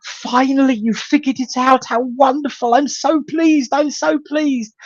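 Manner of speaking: high, excited squeal of joy; high thrilled voice of a church friend finally hearing the name; joyful cheer. Retold or imitated. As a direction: gush with delight like she's been waiting for years. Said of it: "she just cheered and jumped".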